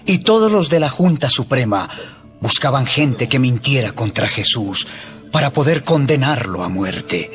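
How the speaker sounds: normal talking voice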